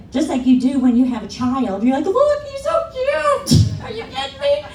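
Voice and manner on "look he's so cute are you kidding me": proud parent showing off the newborn, gushing. Pretend. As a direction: coo like a besotted new parent. Proud parent gushing over baby.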